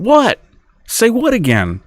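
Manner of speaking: baffled husband. Retold or imitated. Acted baffled husband answers.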